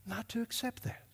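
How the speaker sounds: near-whispered aftermath of an outburst; sudden hush. Real, not acted. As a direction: pull right back to a hushed finish. Hushed drop after the shout.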